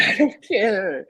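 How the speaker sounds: laughing it off. Real, not acted. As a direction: chuckle through the words. Laughing off friend's point.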